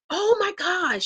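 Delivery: a touched little gasp of a line; lightly voiced delighted neighbor; neighbor's delight. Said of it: "She says".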